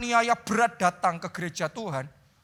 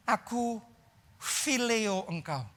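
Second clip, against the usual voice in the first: softer, hesitant admission; humble reply; 6 dB quieter, slower than usual. Retold or imitated. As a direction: confess it quietly, slowly on fileo. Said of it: "Dia hanya jawab gini".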